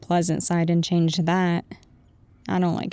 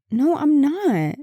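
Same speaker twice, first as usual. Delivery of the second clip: flustered denial; 6 semitones higher, slower than usual. Acted. Denies blushing while pinned to wall.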